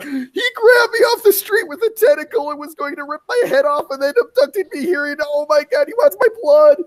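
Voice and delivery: high, sobbing, panicked pleading; crying in terror. Acted. Abducted woman telling Hattie what happened, crying in terror. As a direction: blurt it out through tears, terrified. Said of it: "She's like".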